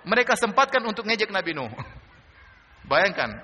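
normal talking voice

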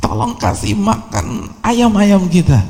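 high, slow, hammed-up mother calling her son; preacher mocks the mother calling Haywah home, high and slow; hammed-up mother's call. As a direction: mimic a shrill old mother summoning her son. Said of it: "Teriak tuh ibunya".

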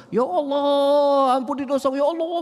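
high, slow, drawn-out wail to Allah; imitating someone shrieking a prayer; mock screaming prayer. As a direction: cry out the prayer like a loud worshipper, hammed up. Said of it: "sampai kepada menjerit".

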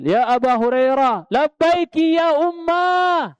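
high, loud, drawn-out call across the house; loud calling reply. Retold or imitated. Preacher acting Abu Hurairah calling back to his mother loudly. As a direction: call out long and high like answering from afar. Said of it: "Kencengan siapa berarti?".

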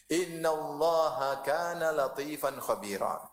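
slow chanted verse; Quran recitation. Acted. Preacher recites a verse to congregation, slow chanted recitation. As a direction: chant it slowly, drawing out the vowels.

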